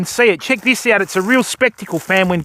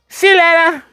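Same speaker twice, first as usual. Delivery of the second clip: high cheeky duck farewell sinking back to his own voice; mock the duck's goodbye, fading down; voicing the duck; 5 semitones higher than usual. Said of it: "the duck's just gone".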